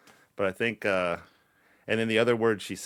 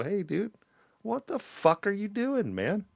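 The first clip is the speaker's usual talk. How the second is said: high, slow mock scolding; a dad getting on his kid; scolding parent; 10 semitones higher, slower than usual. Father reprimanding his kid, mock stern.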